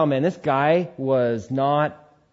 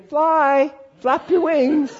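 God urging; 13 semitones higher, 4 dB louder, much slower than usual. Retold or imitated. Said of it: "And I'm hearing".

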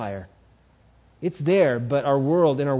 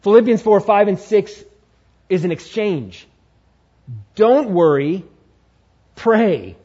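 louder preacher voice, driving the point home; preaching at full force; 4 semitones higher, 6 dB louder than usual. Acted. Pastor hammering the exchange home to his church, loud preaching. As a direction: proclaim it to the church.

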